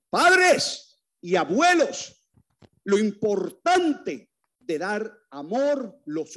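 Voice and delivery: high, loud and slow, each word stretched out; emphatic appeal. Real, not acted. Preacher urging parents and grandparents, slow and loud. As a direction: press it on the parents slowly.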